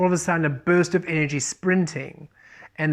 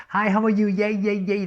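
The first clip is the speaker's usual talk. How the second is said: higher, louder, gushing fake cheer; mock people pleaser; 5 semitones higher, 3 dB louder than usual. Reader mocking people pleasing instead of shining, gushing high. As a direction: play the eager people pleaser, over-bright.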